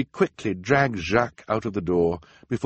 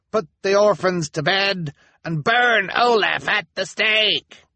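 much higher, loud shouting demand; crowd shout; 13 semitones higher, 6 dB louder than usual. A narrator's reading. Townsperson demanding Olaf burn at the stake, high shout. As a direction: shout it like an angry mob. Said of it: "called out a member".

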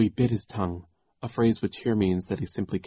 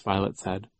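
ordinary delivery, nothing marked

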